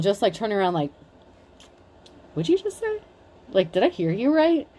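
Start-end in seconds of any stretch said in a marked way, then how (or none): 2.3-4.7 high, incredulous, not aggressive; mock calm disbelief; very calm challenge. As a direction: turn around slowly and ask calmly. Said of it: "a slow turnaround, very calmly".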